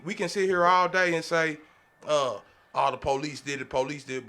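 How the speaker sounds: usual speaking voice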